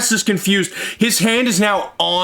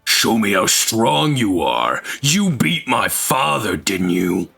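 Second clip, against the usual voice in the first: deep mocking villain taunt; low taunting challenge; menacing villain; 9 semitones lower than usual. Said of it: "and just goes".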